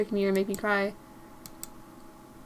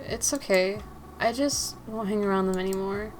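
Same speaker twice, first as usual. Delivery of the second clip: ordinary talk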